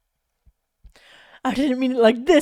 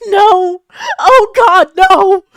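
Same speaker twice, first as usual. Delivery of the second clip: much higher, much louder anguished scream; 9 semitones higher, 14 dB louder, much slower than usual. A narrator's reading. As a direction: scream it out in grief. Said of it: "Anzu just broke down in tears".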